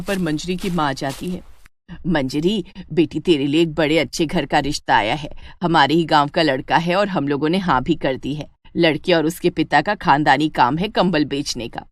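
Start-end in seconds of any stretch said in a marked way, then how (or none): 2.1-11.9 lower-pitched, measured motherly voice; Manjari's kindly mother. Acted. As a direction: break good news as the mother.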